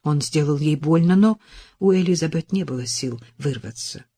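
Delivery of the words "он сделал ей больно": ordinary delivery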